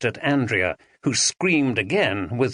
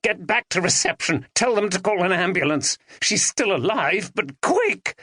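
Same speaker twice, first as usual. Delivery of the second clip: Ben, urgent; 8 semitones higher than usual. A narrator's reading. Ben urging help.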